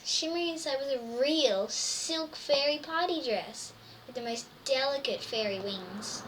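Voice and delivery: usual speaking voice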